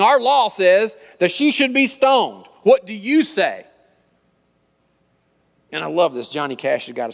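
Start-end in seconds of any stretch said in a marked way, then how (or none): 0.0-3.6 loud, high, slow public accusation; raised, drawn-out mock confrontation; acted accusers' challenge. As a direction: throw down the demand like an accusing crowd. Acted accusers challenge Jesus over woman.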